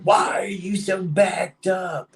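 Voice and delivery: high, slow mock yell; imitating angry manager. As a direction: bark it like an annoyed boss. Manager yelling during an argument.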